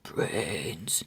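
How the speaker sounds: low, hushed zombie groan; a lightly voiced zombie moaning for brains; zombie grunt. Zombies lurching past grunt low. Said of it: "Grunts of".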